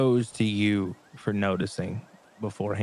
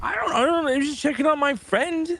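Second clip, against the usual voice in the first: high panicked squeak; 4 dB louder than usual. Acted. Panicked high defensive outburst.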